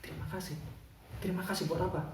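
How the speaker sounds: very soft, puzzled question; quiet acted astonishment; startled. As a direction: ask it in hushed disbelief. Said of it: "Bapak ini langsung terkejut".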